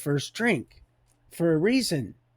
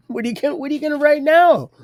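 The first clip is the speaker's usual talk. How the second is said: teasing; 10 semitones higher, 5 dB louder, much faster than usual. Retold or imitated. God teasing the scribe, retold.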